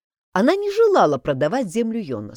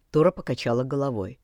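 ordinary delivery, nothing marked

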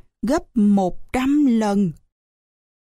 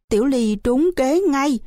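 plain reading voice

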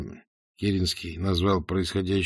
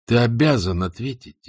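usual speaking voice

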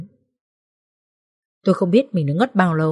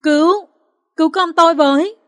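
high, loud, panicked cries; terrified screaming for help; 9 semitones higher, 5 dB louder than usual. Acted. Injured mother alone in the alley, screaming for help. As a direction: scream for help while bleeding. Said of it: "kinh hãi bật khóc gào lên".

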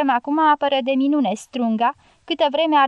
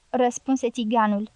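plain reading voice

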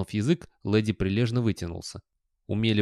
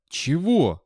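higher, appalled query; horrified question; 6 semitones higher, 4 dB louder than usual. A narrator's reading. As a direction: ask it in dawning horror. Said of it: "спросил Виктор с ужасом".

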